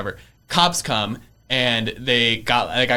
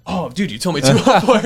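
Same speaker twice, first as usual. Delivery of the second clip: louder, higher, half-laughing complaint; exasperated protest; 6 semitones higher, 7 dB louder than usual. Retold or imitated. Complaining to the contractor about the wrong unit, exasperated. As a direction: throw up your hands at the contractor.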